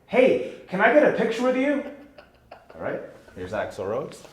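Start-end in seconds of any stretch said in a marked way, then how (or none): 0.1-1.8 bright greeting, much higher and louder; eager fan greeting. Acted. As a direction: beam at your idol. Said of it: "I'm Sammy".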